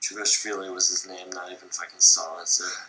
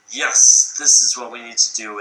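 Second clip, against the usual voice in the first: mock command, much louder than the telling; commanding Stalin; 9 dB louder than usual. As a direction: bark it like the supreme commander. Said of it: "and say".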